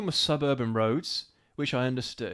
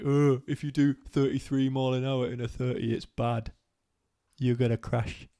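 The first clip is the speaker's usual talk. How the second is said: put-on whiny voice. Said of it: "That's not a Welsh accent".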